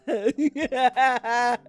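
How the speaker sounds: cracking up mid-sentence; laughing. Real, not acted. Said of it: "was laughing so hard".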